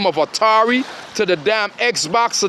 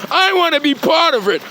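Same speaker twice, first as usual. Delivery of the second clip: higher mock voice of a hanger-on; mocking whiny imitation; 6 semitones higher than usual. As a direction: sneer at them by aping their plea, pitched up. Mocking the stalkers on his phone.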